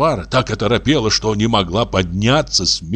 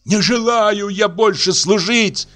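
much higher, tearful furious outcry; Aksinya screaming in tears; 11 semitones higher, slower than usual. A narrator's reading. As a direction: wail out her grievance at the in-laws. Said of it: "продолжала она". (рыдающий гневный крик, намного выше)

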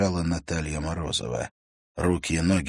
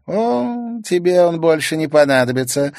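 high, loud mocking girl; laughing Sayana; 11 semitones higher, 8 dB louder than usual. A narrator's reading. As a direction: laugh it off at him. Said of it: "рассмеялась Саяна".

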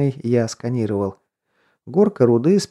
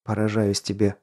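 ordinary delivery, nothing marked

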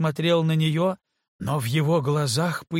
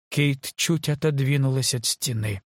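usual speaking voice

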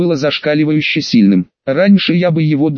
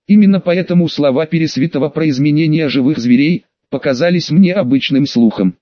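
ordinary delivery

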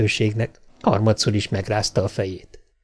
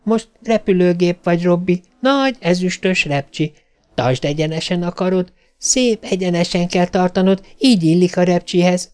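put-on higher child voice, instructing in play; bossy little girl; 7 semitones higher than usual. A narrator's reading. Little girl directing her robot airplane, playfully bossy. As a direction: coach the robot like a playing child.

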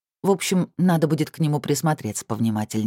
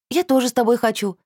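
excited; 10 semitones higher than usual. A narrator's reading. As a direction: bounce with eager excitement. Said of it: "восторженно подскочила Анжелика".

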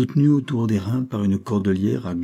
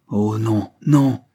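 crying out; 5 dB louder, much slower than usual. A narrator's reading. Gilles rejecting the canon's plea, crying out. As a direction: cry out the refusal. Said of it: "s'écria Gilles Pérez".

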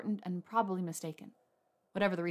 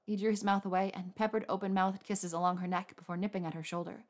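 ordinary delivery

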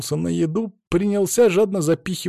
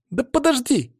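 put-on high-pitched boyish call; 12 semitones higher than usual. A narrator's reading. Boy runs after the man, high insistent call. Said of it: "окликнул меня пацан".

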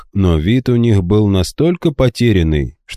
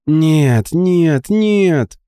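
despairing; 10 semitones higher, much slower than usual. A narrator's reading. Waking friends fail.

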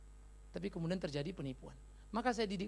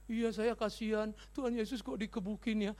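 slow, drawn-out whimper; imitated tearful child. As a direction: mimic a child sorrowfully explaining the tears. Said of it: "Kenapa nangis?".

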